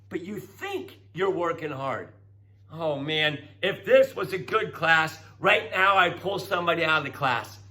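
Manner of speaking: normal talking voice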